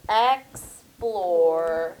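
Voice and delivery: "explore" slowly split, ex then plore; syllable by syllable. Shown as a demonstration. Teacher finding the second syllable, "explore" in two slow beats. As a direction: stretch each half of "explore" with a gap between. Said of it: "we're going syllable by syllable".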